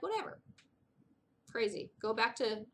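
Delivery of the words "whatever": "Whatever." pitched much higher with a rising shrug; mock dismissive. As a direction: toss off "Whatever." high and breezy, brushing it away. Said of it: "So I'm like".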